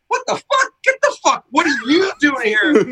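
hammed-up disbelief at being questioned; mock outrage. Retold outrage at Rob's question.

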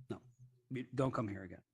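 ordinary talk, nothing marked

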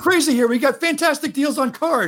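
high-pitched mock radio car ad; car-dealer ad pitch. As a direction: rattle it off like a crazy car salesman. Mimicking radio commercial read.

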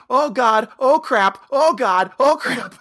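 put-on high, panicky Minnesota accent. Gladys as the float burns, panicky Minnesota accent. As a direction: imitate a flustered Minnesota woman with long round O's. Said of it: "most Minnesota thing ever".

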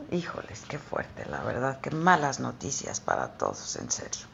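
hushed whisper. Retold or imitated. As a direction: whisper it as an aside. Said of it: "como diciendo".

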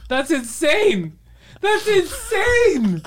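very high, strained disbelief; incredulous outburst. Real, not acted. Exasperated argument over a false belief.

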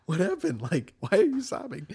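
high, startled, concerned asking; alarmed question. Retold or imitated. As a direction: voice jumps up high in surprise at her crying. Man startled by girl sobbing nearby.

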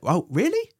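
high, incredulous rising reaction; mock surprise. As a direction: react with put-on amazement. Acted surprised reaction to radio news.

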